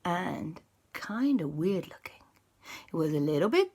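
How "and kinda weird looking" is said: eerie hushed aside; spooky whisper. A narrator's reading. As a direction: whisper the creepy detail. Spooky description.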